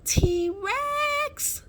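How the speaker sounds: high piercing scream of terror. A narrator's reading. Olivia screaming a warning to the others as she flees, piercing scream. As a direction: shriek the warning as loud as you can. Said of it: "she screamed".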